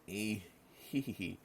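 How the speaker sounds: high hee-hee giggle; acted tittering laugh in character. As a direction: giggle out separate little hees. Reader voicing character's giggle.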